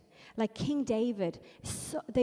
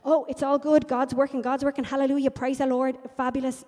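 loud, higher, falsely upbeat gushing; mock cheerfulness; 4 semitones higher, 8 dB louder, faster than usual. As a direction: put on over-the-top church positivity, mocking it. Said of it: "I'm not gonna be like".

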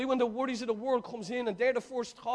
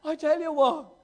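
ordinary delivery

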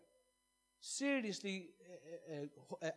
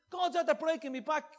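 blurted out loud; 8 semitones higher, 7 dB louder than usual. Retold or imitated. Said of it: "he just blurted it out".

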